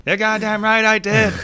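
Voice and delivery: high, laughing defiant admission; defiant movie quote. Retold or imitated. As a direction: blurt it out proudly, half laughing. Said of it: "A Few Good Men".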